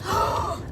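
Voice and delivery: a shocked intake of breath; sharp gasp. Real, not acted. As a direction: gasp at the sight. Sees a crash while driving.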